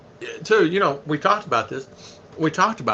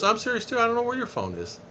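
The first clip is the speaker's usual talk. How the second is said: higher, softer voice imitating his wife; mock-innocent denial, pitched up and quiet; teasing wife; 7 semitones higher, 5 dB quieter, faster than usual. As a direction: play the wife feigning ignorance, lightly. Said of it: "she's always teasing me".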